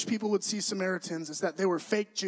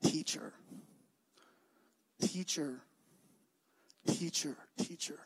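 each Teacher softer than the last, down to a whisper; soft fading repetition; 4 semitones lower, 9 dB quieter than usual. Acted. Pastor echoing Martha's title for Jesus, fading to a whisper. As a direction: let Teacher fade into a breath.

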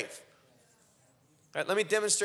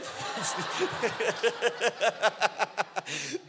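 joyful laugh. Real, not acted. Laughs recounting the girl's words.

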